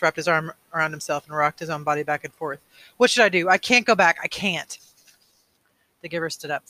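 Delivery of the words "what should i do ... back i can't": raised, loud, then falling away; despairing plea. A narrator's reading. Boy who cannot go home, despairing plea. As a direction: cry out helplessly and sink at the end.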